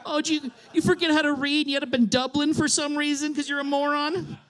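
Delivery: mock their troubles with fake pity; mounting mock anger. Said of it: "I just feel myself getting angry".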